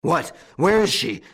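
high, loud roar of alarm. A narrator's reading. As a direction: roar the questions urgently. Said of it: "roared old Goussot".

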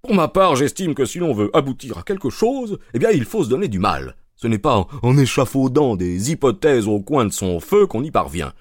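lightly voiced smug inspector; Lestrade voice. A narrator's reading.

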